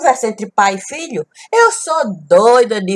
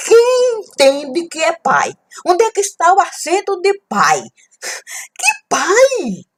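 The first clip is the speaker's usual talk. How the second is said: indignant high questioning; 11 semitones higher than usual. Acted. Indignant retort about pai.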